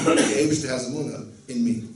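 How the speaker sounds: ordinary delivery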